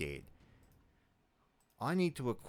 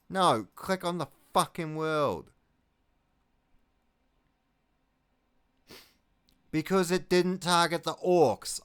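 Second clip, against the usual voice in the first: higher and louder, spitting out each complaint; angry rant; 6 semitones higher, 8 dB louder than usual. Real, not acted. Commander yelling at his marines for attacking Tyranids, furious. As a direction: rant at the troops who attacked the wrong target. Rants at units attacking wrong target.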